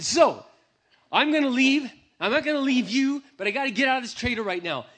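loud, high, agitated; about to blow up, telling her he's leaving; heated announcement. Retold or imitated. Angry husband announces leaving.

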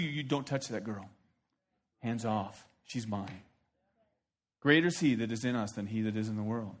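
low, soft, protective command; God warning off demonic spirits; quiet stern God. Acted. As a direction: hush it like a fierce guardian. God voiced protecting believer.

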